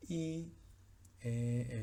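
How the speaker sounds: ordinary talk, nothing marked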